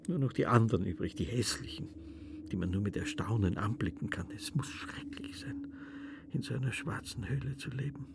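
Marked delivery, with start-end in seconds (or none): 4.3-8.1 frightened whisper. Acted.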